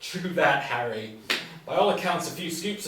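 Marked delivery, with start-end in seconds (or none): none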